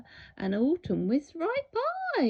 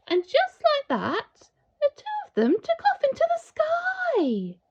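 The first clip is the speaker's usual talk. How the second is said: much higher, soaring voice; triumphant; 6 dB louder than usual. A narrator's reading. Narrator as boy and dinosaur take flight. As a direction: lift off with joy.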